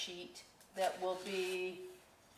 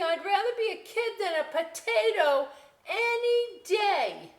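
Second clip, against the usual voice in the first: high, loud outraged rant; yelling; 13 semitones higher, 9 dB louder than usual. Acted. Girl yelling at the bored potato, high outraged rant. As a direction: let the potato have it, full volume. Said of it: "She's yelling".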